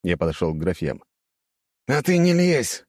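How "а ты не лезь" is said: high-pitched warning to back off; snapped shout. A narrator's reading. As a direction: cry out crossly, voice pitched up. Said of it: "крикнул извращенец".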